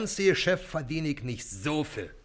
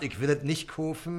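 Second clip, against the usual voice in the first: ordinary talk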